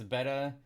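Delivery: "beta" as in the fish, BET-uh; "beta" rhyming with "feta"; short-e pronunciation. Shown as a demonstration. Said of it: "when we're talking about fish".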